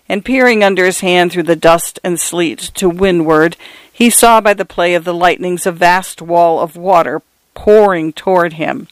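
ordinary delivery, nothing marked

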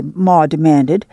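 ordinary delivery, nothing marked

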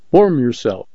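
ordinary delivery

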